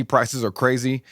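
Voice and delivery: ordinary delivery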